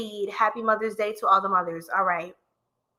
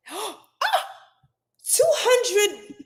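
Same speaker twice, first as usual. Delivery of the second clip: ordinary talk, nothing marked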